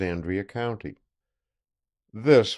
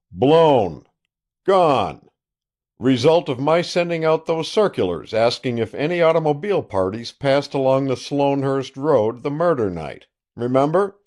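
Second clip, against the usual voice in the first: loud, boastful country sheriff on the phone; a gloating sheriff calling an old friend; triumphant sheriff; 6 semitones higher, 5 dB louder than usual. Acted. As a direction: crow over the news like a self-satisfied lawman.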